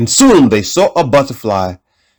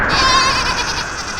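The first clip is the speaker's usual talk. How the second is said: goat bleat; 7 dB quieter than usual. Acted. Goat bleat before speaking.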